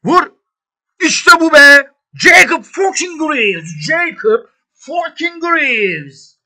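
high, loud, slow triumphant roar; celebrating a goal, ecstatic shout; goal celebration. Real, not acted. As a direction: drag out the scorer's name in joy.

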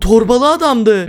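loud, high, stretched-out exclamation; alarmed shouting. Real, not acted. Player telling friends it was the bag man, alarmed. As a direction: call it out slowly in alarm.

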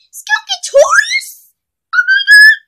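very high, loud squeal of delight; excited shriek. Pretend. Toy friends shriek with joy at Skunkatorius arriving, squealing.

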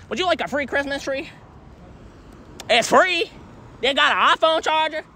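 eager sales pitch. Real, not acted.

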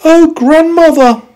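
put-on very high, loud, slow girl voice; little girl crying out. A narrator's reading. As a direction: pipe it up high in amazement. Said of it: "she cried".

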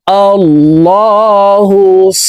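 "আল্লাহুস" with thick l, long vowel, held s; heavy lam. Shown as a demonstration. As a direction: chant "আল্লাহুস" slowly with dark l. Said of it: "এই লামকে ল বলে পড়তে হবে".

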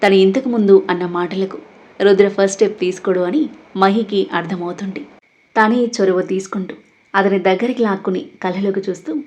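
ordinary delivery, nothing marked

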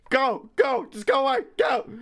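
high squeaky creature pleading; high-pitched mock creature voice; monster voice. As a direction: squeal it out like a tiny scared monster. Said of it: "and it's like".

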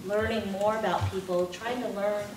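normal talking voice

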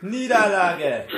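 laughing. Real, not acted. Mock announcing the defeat.

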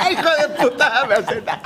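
high, laughing curse; laughing hard. Real, not acted. Host cracking up at the picture behind him, laughing high. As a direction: swear helplessly through real laughter.

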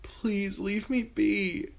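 high, slow, frightened pleading; girl begging the king to leave; whimpering plea. A narrator's reading. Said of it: "she whimpered".

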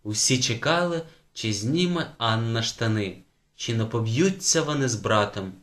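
ordinary delivery

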